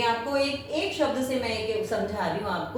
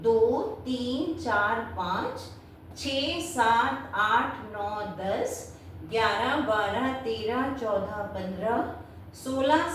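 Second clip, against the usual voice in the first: slow, deliberate counting aloud for students; 2 semitones lower, 3 dB quieter, slower than usual. Shown as a demonstration. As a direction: count each number out slowly. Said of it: "अब का से ज्ञा आप count करोगे". (धीमी, ठहरकर गिनती)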